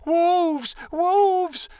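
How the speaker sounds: shrill, very high full-throated alarm shout. A narrator's reading. Scene: Amira alerting the whole city, screaming. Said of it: "heard Amira screaming".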